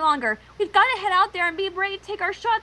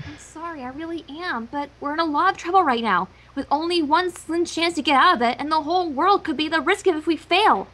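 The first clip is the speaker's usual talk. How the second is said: gently; 6 semitones lower than usual. Acted. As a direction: lower and gentle, a lightly voiced apology. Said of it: "As gently as she could".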